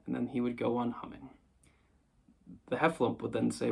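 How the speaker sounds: plain reading voice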